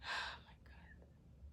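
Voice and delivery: breathy whispered oh my God; exhausted whisper after a sigh; whispered relief. Real, not acted. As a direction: let it out on a sigh, voiceless.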